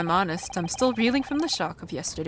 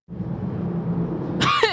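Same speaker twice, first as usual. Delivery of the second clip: wild high cackle. Acted. Prisoner's wild laugh.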